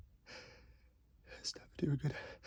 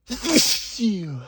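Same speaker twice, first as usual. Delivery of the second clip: sneeze; 3 semitones higher, 13 dB louder than usual. Acted.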